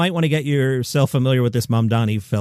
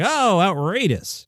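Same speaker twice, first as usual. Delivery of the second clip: drawn-out, higher-pitched pretend shock; mock outrage; 6 semitones higher, slower than usual. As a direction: feign being scandalised, stretching it out. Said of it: "I wasn't like".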